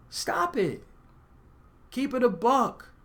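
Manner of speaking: high-pitched, drawn-out "come on now"; mock scolding. Speaker to church-going women he doubts, high-pitched scolding. Scolding retort to religious posers.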